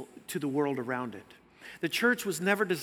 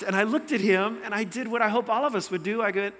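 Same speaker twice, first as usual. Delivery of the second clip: ordinary delivery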